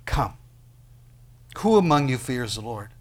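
ordinary talk

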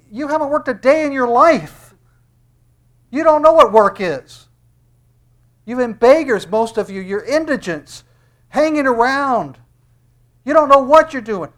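high, loud preacher's tirade; scolding rebuke. Acted. Preacher rebuking people who never worked, loud scolding. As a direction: berate them at full preaching force. Preacher berates the crowd in God's voice.

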